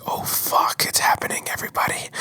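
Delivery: breathless whispered aside to the audience; excited whisper. Retold or imitated. Retelling his hopes rising, a hushed excited whisper. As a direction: whisper it like a secret thrill. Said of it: "I was just like".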